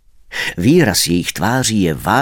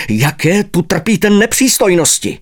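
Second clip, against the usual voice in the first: a raised, indignant snap at the café owner; snapping at the owner; 7 semitones higher than usual. Acted. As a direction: bark the complaint up high, affronted. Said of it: "Osopil jsem se na něho". (pohoršeně se osopit na kavárníka)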